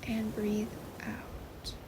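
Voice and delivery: soft, very slow, breath-like meditation voice; guided breathing cue. Acted. Guide leading listeners into sleep meditation, soft and slow. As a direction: ease them softly through one slow breath.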